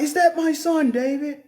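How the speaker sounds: sugar-coat it, you don't mean it; fake sweet talk. Saul calling out to David, high fake sweetness. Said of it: "I'm just playing".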